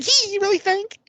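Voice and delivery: high, squeaky, delighted voice; eager falsetto. Retold or imitated. Wes gushing at Angel's praise in a high squeak. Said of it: "Wes is like".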